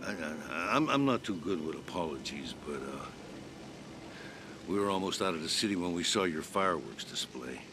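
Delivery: low, hesitant, awkward admission; halting apology. Acted. Halting apology to former leader.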